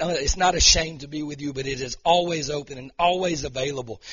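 a pastor's impassioned declaring prayer; fervent prayer. Real, not acted. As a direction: pray over her with building force. Pastor prays over child.

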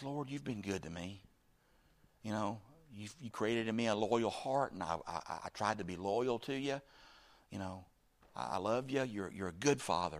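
soft, humble talk to God; tender prayer. Retold or imitated. King praying to the Lord after bad news, tender and soft. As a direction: pray it quietly, as to a good father.

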